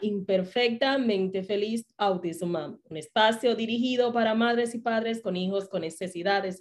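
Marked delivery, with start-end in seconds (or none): 0.0-2.8 radio host greeting. Acted. Host opens the show.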